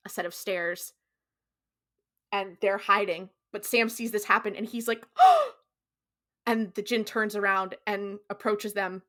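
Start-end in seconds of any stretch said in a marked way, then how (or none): 5.2-5.5 sudden loud, high gasp; imitated shocked gasp. Hiding Sam sees the djinn attack, shocked gasp. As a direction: gasp sharply in mock horror.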